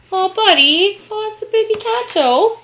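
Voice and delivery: high, sing-song cooing at a cat. Real, not acted. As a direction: coo at the kitty.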